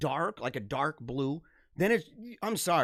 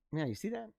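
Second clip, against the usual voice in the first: normal talking voice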